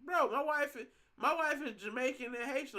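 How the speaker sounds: as if the question were absurd; indignant. Real, not acted. Telling chat his wife is Jamaican, indignant. Indignant reply about the rum.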